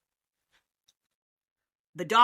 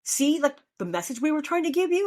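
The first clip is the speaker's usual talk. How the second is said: exasperated friends; 8 semitones higher than usual. Retold or imitated. Friends scolding her after the airport, higher exasperated disbelief. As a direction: throw up your hands in disbelief. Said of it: "and they said".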